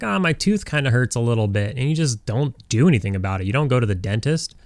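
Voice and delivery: normal talking voice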